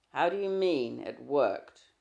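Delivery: suspicious. Acted. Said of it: "He sounded suspicious".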